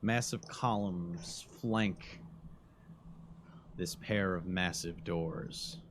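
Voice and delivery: slow, hushed scene-setting narration. Acted. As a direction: paint the scene slowly and quietly. GM describes the ruined doorway.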